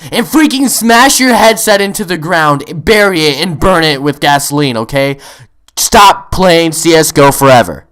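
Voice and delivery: very loud, forceful acted rant; shouted tirade. Gamer telling Kyle off over voice chat, shouted tirade. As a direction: blast out each command.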